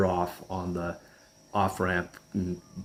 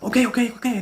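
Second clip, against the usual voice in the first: high, loud nervous okays; nervous Stevie; 5 dB louder, faster than usual. Retold or imitated. Stevie agreeing while freaking out, high and loud. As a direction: rattle them off high and jittery. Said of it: "He's like".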